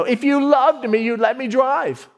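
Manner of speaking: put-on high, sulky kid voice; whiny twelve-year-old. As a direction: whine like a kid who's been told no. Kid whining at dad.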